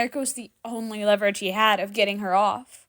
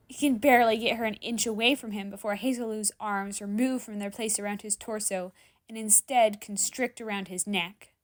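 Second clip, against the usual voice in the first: ordinary delivery, nothing marked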